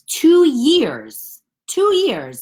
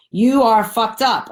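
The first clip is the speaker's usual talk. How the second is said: angry yearbook jab; 5 semitones higher, 6 dB louder than usual. Retold or imitated. Quoting angry yearbook line.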